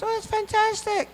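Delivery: very high, slow falsetto mum; imitated mother. Mum hears of doctor role, high falsetto delight. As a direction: coo it as a thrilled mum, falsetto. Mother replies in retold call.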